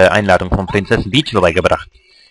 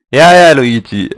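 teasing; 3 semitones higher, 5 dB louder, slower than usual. Acted. Teasing Luigi's jealousy.

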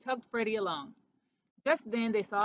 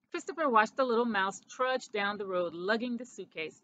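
usual speaking voice

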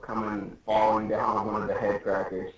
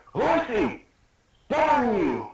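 high-pitched dramatic cry; mock anime yell; acted shout; 13 semitones higher, 4 dB louder, slower than usual.

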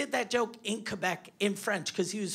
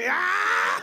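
high, loud comic scream down the phone; exaggerated yell of dismay; mock panicked scream; 11 semitones higher, 5 dB louder than usual. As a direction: scream in hammed-up horror to the wife. Calls wife in imagined panic.

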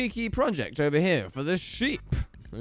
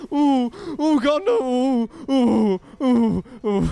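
loud higher pained ooh; 5 semitones higher, 6 dB louder, slower than usual. Real, not acted. Player taking hits, pained oohs. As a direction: wince out loud at each hit. Hit repeatedly.

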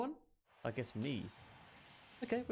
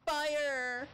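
much higher, loud shout; shouting attack name; 11 semitones higher, 3 dB louder than usual. Real, not acted. Gamer calling out the fire attack at full shout. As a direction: yell the move like a battle cry.

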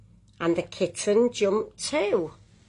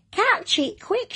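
urgent shout; 11 semitones higher than usual. A narrator's reading. Nicky urges the family to catch the kitten, high mock shout. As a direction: cry out high, urging someone to act. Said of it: "shouted Nicky".

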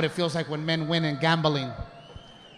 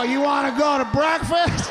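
put-on drunk cheerful call; 8 semitones higher, 4 dB louder than usual. Drunk husband invites wife.

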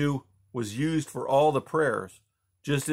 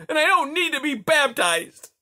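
high and loud defiance; fierce outburst; 6 dB louder than usual. A narrator's reading. Boy refusing baptism from the Tsar, fierce. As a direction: fling it back at him. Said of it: "he burst out fiercely".

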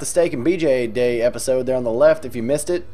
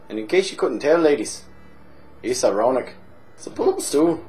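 boozy Irish pub regular, chatty and cheeky; hammed-up Irish drunk telling a tale; stage Irish accent. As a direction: lay on a thick comic Irish brogue. Held Irish barfly voice.

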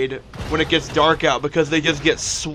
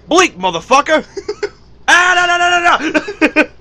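higher, louder mock yelling; 13 semitones higher, 7 dB louder than usual. Yelling at the game cat, higher mock yelling. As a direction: shout at the cat in mock panic.

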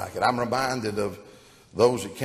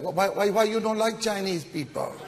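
Chinese man voice; 9 semitones higher than usual. Acted. Co-pilot questions the pilot.

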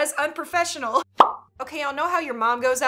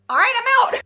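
high, loud hasty retreat; mock panic; 9 semitones higher, 7 dB louder than usual. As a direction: blurt it out as you flee. Caught snacking, fleeing from mom.

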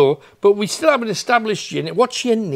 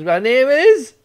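mock spirit voice; 6 semitones higher, 5 dB louder, slower than usual. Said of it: "She says".

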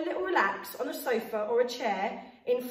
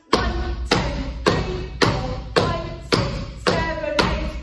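loud counting; 3 semitones higher, 6 dB louder, much slower than usual. Shown as a demonstration. Loud stamps grand finale counting.

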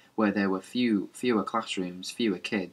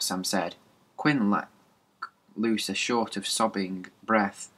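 ordinary delivery

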